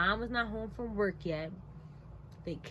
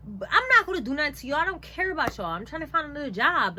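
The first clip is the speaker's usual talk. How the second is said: raised, high, hurried retort; heated dismissive reply; exasperated protest; 5 semitones higher, 6 dB louder, faster than usual. Retold or imitated. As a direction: fire back indignantly at the accusation. Acted retort to staff feeling threatened.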